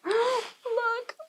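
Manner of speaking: little bear. A narrator's reading. Little Wee Bear spots mess.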